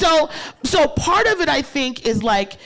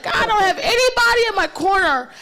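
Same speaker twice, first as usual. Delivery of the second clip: despairing cry; 11 semitones higher, 4 dB louder, faster than usual. Retold or imitated. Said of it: "I was like".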